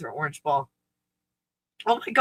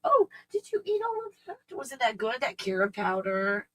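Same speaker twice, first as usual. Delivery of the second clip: put-on doggy baby talk. Doting owner cooing to her spoiled dog, baby talk.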